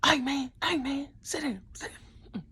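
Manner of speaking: high, loud call to sit down; mock stern yell. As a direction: play the strict coach barking at kids. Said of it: "I could be somebody who's like".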